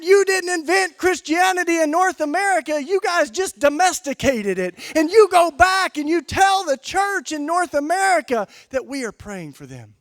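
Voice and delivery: high-pitched, full-volume acted scolding; shouted rebuke. Bishop rebukes a North American visitor, shouting with passion. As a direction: hammer it home at a shout, as the bishop.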